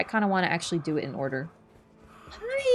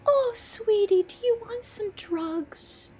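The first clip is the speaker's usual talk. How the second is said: Grandam doting; 10 semitones higher, slower than usual. Retold or imitated. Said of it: "And she goes".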